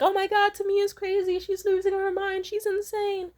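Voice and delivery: high loud mock panic to the household; mocking mom. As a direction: shriek it out to paint her as crazy. Mom mocks daughter as crazy.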